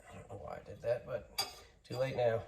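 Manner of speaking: ordinary talk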